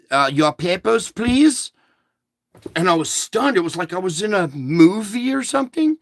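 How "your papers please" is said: imitated German clerk, rising high at the end; German accent. Shop clerk repeating her demand to a customer, imitated German accent. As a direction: mimic her German accent, lifting the last word. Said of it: "with a German accent".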